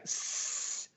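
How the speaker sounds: soft, drawn-out hissing sizzle; imitated sizzle. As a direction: mimic a phone frying with a hiss. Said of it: "like, sizzles right in front".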